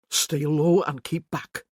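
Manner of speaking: slow, words around a blade; blade in teeth. A narrator's reading. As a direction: speak through clenched teeth. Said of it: "he whispered around the blade".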